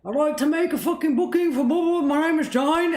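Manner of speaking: high, fast, garbled Brummie caller; mock Brummie accent. Caller booking a restaurant table in a mock Brummie accent. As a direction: imitate a Brummie rattling off a phone booking. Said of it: "oh, the Brummie accent?".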